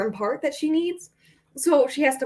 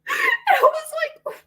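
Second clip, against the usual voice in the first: mock sob; 3 dB louder than usual. Real, not acted. Touched by the dog's card.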